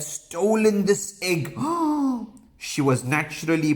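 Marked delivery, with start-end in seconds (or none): 1.6-2.3 high, theatrical gasp; storyteller's scandalized gasp; shocked gasp. Pretend. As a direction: gasp in mock shock for the listeners. Shock at the stolen egg.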